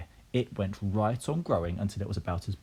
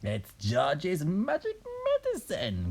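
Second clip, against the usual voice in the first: high, drawn-out granny call; shouting Grandma; 10 semitones higher, slower than usual. A narrator's reading. Grandma explaining to Mary, slow high mock shout. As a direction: stretch it out in a high put-on voice. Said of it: "Grandma shouted".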